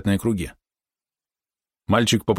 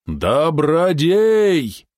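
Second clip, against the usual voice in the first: long, high cry of despair; strong, despairing call; 8 semitones higher, 4 dB louder, much slower than usual. A narrator's reading. Father desperately seeking his kidnapped son, despairing call. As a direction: cry out the name, stretched and strong. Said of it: "В сильном, удивительно красивом голосе Вяча слышалось отчаяние".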